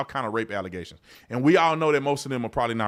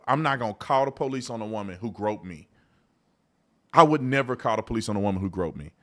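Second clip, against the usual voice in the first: ordinary talk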